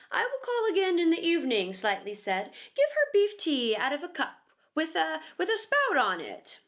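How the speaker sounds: a boy pretending to be a doctor; lightly voiced mock physician; pompous doctor. A narrator's reading. Slightly plays doctor.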